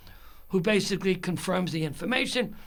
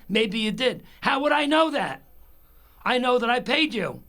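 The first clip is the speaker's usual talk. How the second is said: high, loud indignant comeback; mad; 6 semitones higher, 5 dB louder than usual. Retold or imitated. Caller insisting to the supervisor that he paid, indignant. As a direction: throw the question back, louder and higher. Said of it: "why I'm so mad".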